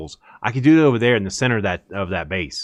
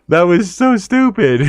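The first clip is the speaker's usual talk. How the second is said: amused; 6 semitones higher, 5 dB louder, slower than usual. Real, not acted. Laughing at the game clip.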